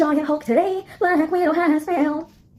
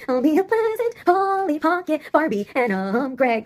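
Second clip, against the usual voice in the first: ordinary talk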